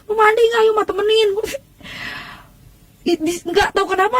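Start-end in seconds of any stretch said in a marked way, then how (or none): 0.0-1.3 high-pitched mock calling; mother's yell. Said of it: "Kata emak saya udah teriak-teriak". (menirukan emak memanggil, nada tinggi)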